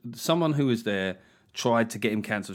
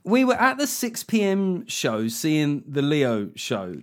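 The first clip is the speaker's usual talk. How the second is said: mocking the complainant with a whiny, patronising read; 3 semitones higher, 4 dB louder than usual. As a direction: read it like a smug complainer, dragging it out. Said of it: "read it in a really patronising, sort of whiny fucking voice".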